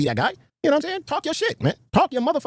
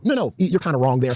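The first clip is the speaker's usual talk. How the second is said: usual speaking voice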